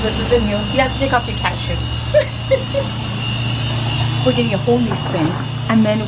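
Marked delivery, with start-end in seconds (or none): 1.8-4.2 squealing airy laugh; a high giggle of embarrassment; breathy squeal of laughter; high breathless laugh. Real, not acted.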